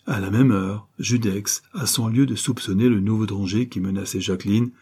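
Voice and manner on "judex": usual speaking voice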